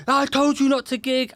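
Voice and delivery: high-pitched imitated outburst; mock-furious, pitched well up; losing his mind. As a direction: act out a promoter flipping out. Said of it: "he starts losing his mind".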